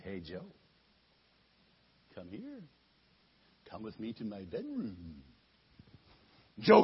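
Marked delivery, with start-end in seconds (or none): 0.0-5.2 seductive wife. Acted. Temptress wife lures Joseph.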